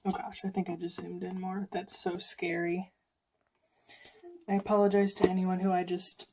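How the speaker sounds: normal talking voice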